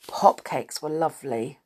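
usual speaking voice

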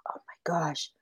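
coyote's whispered gasp to himself; hushed exclamation. Acted. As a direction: breathe it out very softly, under the breath. Coyote spots the rabbit, to himself.

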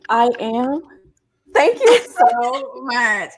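delighted, drawn-out gushing; excited thanks. Acted. Comic imagining getting the teacher's remark as praise, excited. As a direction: gush it slowly, thrilled by the compliment. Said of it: "I would have been so excited".

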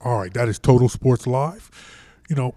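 usual speaking voice

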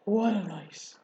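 husky, worn-out rat voice. Acted. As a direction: rasp it out after a long night. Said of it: "he said in a husky voice".

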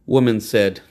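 usual speaking voice

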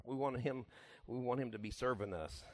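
normal talking voice

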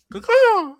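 loud high bird caw; crow caw. Pretend. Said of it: "pigeons don't sound like that".

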